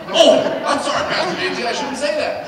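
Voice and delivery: loud, high apology through laughter; hammed-up sorry, laughing; laughing mock apology. Friend apologizing to Pastor J T, loud and laughing. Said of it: "but he catches one".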